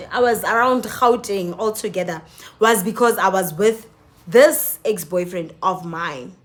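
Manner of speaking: ordinary talk, nothing marked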